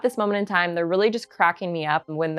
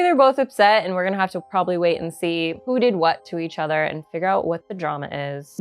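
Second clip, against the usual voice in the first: ordinary talk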